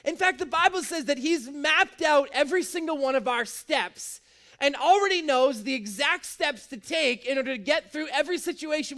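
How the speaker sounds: loud, high, driving sermon voice; full-force preaching. Acted. Preacher proclaiming to the congregation, full force. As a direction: preach it out big and bold to the room.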